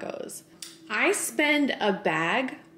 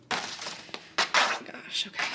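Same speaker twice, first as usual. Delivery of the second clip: slow breathless whisper of awe; awed whisper; 5 dB quieter, slower than usual. Real, not acted. Unboxing a gift for herself, an awed slow whisper. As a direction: barely voice it, stunned by the box. Opening a big gift box.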